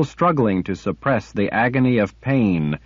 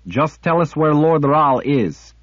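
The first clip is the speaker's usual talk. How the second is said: louder, pressing hard; angry insistence; 6 semitones higher, 4 dB louder than usual. A narrator's reading. Kara pressing the sliff, angry. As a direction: force the answer out.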